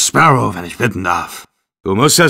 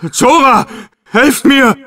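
calling for help; 12 semitones higher, 3 dB louder, slower than usual. Acted.

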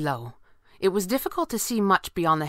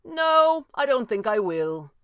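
high, raised refusal; pitched-up, carrying hero's voice; calling out; 7 semitones higher, slower than usual. A narrator's reading. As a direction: call a refusal down from above. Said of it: "the red panda called".

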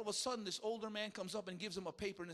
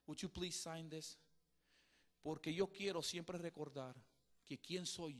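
soft, much quieter, near tears; tearful plea; 5 semitones lower, 7 dB quieter than usual. Retold or imitated. Teacher with tears asks the young man, quietly tearful. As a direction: ask it gently, voice almost gone.

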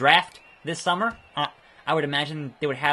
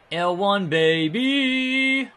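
excited whoop; 6 semitones higher, 4 dB louder, much slower than usual. Real, not acted. Player celebrates pressing the button, excited. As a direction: sing it out high and long. Triumphant button call in game.

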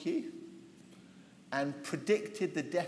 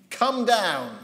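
higher, louder menacing order; sinister command; 5 semitones higher, 9 dB louder than usual. Acted. Captain ordering the prophet off the hill, loud and menacing. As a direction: bark it down the hill as a threat. It's a bit more sinister.

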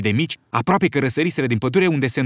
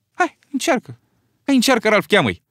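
eager urging; 9 semitones higher, 3 dB louder than usual. Acted. Piggy urges Ralph to blow.

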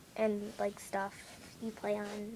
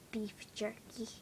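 breathy secret whisper; hushed confession of love for jerky; 15 dB quieter, slower than usual. Acted.

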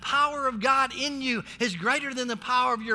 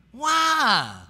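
loud rhetorical why; 6 dB louder than usual. Real, not acted. Rhetorical call to the church.

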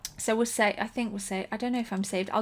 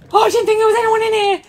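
loud, high, rushed shock; real fright, shouted fast and high; startled scream; 11 semitones higher, 12 dB louder, much faster than usual. Real, not acted. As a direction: shriek it out as if jumped. Startled by hidden enemy in game.

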